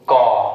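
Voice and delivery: कॉ loud, with a long open aw; कॉ said like "caw", no U glide; model pronunciation. Shown as a demonstration. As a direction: drill कॉ firmly as one open vowel. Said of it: "इसे पढ़े कॉ".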